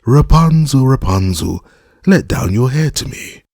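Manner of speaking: high, sung-out call up to a tower; storybook enchantress calling, pitched high. A narrator's reading. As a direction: call up to someone far above, first word loudest. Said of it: "placed herself beneath it and cried".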